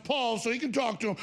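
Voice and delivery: ordinary talk, nothing marked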